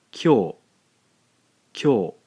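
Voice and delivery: "Kyo" as one loud clear syllable; one beat, k gliding into yo in "Kyo"; blended kyo. Shown as a demonstration. Teacher calling out the blended syllable, loud and clear. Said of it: "a single syllable again".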